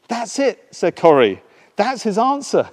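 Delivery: high, loud eager insistence; Betsy urging Corrie to thank God, excited. Acted. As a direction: urge it with joy. Betsy eager, found the answer.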